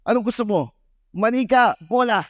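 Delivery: high, teasing quiz voice; teasing host. Retold or imitated. Host quizzing the son at the party, high and teasing. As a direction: tease the boy with a choice.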